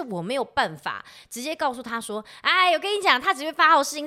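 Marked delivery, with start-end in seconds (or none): 2.4-4.1 louder, higher, blunt warning; blunt mom. Pretend. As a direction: tell it bluntly, loud. Hypothetical blunt mom talk.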